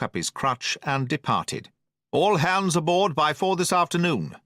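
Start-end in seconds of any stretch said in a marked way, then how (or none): 2.1-4.4 calls after him, raised; shouted order. A narrator's reading. Squire calling after the cook. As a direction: call the order after a departing man. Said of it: "shouted the squire after him".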